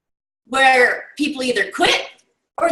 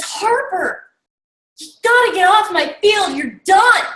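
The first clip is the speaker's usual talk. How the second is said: shouting coach; 7 semitones higher than usual. Retold or imitated. Coach ordering Harper off his field, shouting. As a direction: yell him off the field for good. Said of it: "the coach turns and says".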